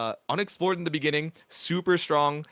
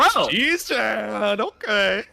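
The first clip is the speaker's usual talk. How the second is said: loud, high-pitched exclamation of astonishment; amazed reaction; 8 semitones higher, 5 dB louder, much slower than usual. Real, not acted. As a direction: blurt it out high and loud, floored by the comeback. Stunned by a sudden comeback.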